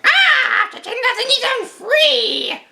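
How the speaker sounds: very high, loud shrieking villain cry; villain Rita shout. Retold or imitated. Imitating Rita shrieking after her release, high and loud. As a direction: scream it like a freed witch. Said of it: "Rita being the whole, like".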